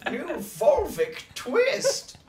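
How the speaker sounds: lightly voiced dinosaur character; Tyrannosaurus Alan. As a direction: put on a goofy dino voice. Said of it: "Tyrannosaurus Alan right here".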